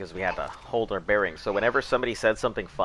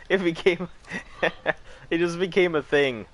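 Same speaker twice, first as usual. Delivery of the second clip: high, laughing through the words; 10 semitones higher than usual. Real, not acted. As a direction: giggle while you talk. Laughing at own story.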